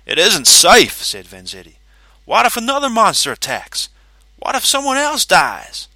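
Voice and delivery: high, loud alarmed pleading; rising panic in the voice. Acted.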